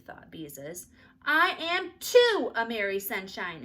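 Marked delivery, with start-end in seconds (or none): 1.2-3.6 put-on loud, slow, stubborn child shout; angry shout. A narrator's reading. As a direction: yell it defiantly. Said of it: "insisted Ramona".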